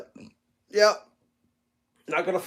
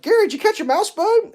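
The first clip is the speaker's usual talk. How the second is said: put-on high, slightly louder baby-talk to the cat; retold cat baby talk; 5 dB louder than usual. Man retelling how he asked his cat about the mouse, high baby talk. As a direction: coo up high like talking to a pet. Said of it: "And I'm like".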